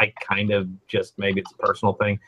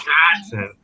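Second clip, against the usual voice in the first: exaggerated Wisconsin accent, drawn-out middle vowel; hammed-up Wisconsin accent; 4 semitones higher, slower than usual. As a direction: say it like a Wisconsinite, a bit higher. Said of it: "You gotta get him to say".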